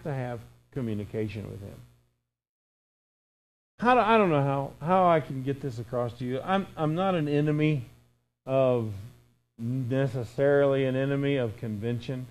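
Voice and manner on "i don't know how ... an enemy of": ordinary talk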